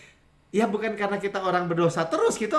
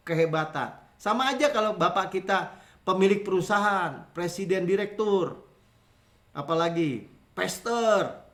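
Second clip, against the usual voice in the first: normal talking voice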